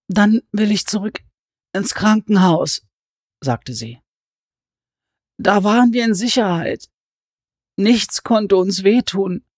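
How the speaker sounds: cling to a lost safety; tragic heroine sorrow. Acted. Alice voiced, tearful.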